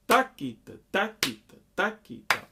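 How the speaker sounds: "Takita" chanted with TA punched high and loud; accented first syllable. Shown as a demonstration. Teacher showing the accent in every "Takita", first syllable stressed. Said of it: "accent the first syllable in every takita".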